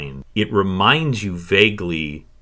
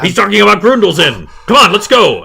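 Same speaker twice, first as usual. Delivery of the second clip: high, loud, rushed alarm; rally your partner to run; hurried yell to a fellow guard; urgent guard shout; 13 semitones higher, 10 dB louder, faster than usual. Acted. Alarmed guard rallies his partner.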